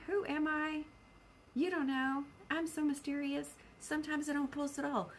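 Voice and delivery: ordinary talk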